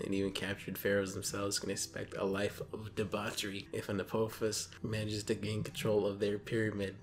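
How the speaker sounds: ordinary talk, nothing marked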